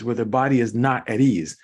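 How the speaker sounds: ordinary talk, nothing marked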